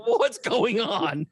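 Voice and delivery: slow, giggling what's going on; laughing disbelief at the scene; laughing question. Retold or imitated. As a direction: ask while cracking up. Confused reaction, laughing.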